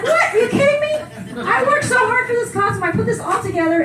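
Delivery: high, incredulous squeal that settles as it goes on; laughing, indignant what; outraged disbelief. Retold or imitated. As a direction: shriek in mock outrage, then calm down. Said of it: "I'm like".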